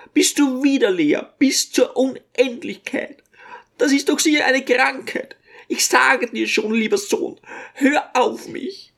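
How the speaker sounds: put-on a fretting little old woman doting on her son; old mother's voice. A narrator's reading. Old mother fussing over her son to the guests, high-pitched.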